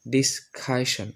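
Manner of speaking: ডিসকাশন slowly split, "dis-KHA-shan", with aspirated k; new pronunciation. Shown as a demonstration. Teacher models for learners, ডিসকাশন as slow "dis-khashan". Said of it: "এই চারটা উচ্চারণ করি".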